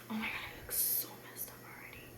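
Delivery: fast whispered aside; hurried whisper to yourself. Real, not acted. Self-deprecating aside to camera.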